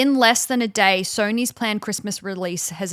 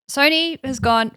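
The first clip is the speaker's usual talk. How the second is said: ordinary talk, nothing marked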